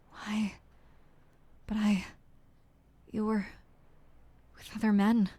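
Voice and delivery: breathy, half-whispered pleading lover; lightly voiced jilted lover, wounded and bitter; hurt lover. Character's pained lines to Jacopo.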